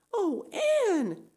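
high, tearful cry of dismay; near tears. Acted. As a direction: let her voice break on the name. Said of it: "looked as if she were ready to cry".

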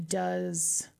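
ordinary delivery

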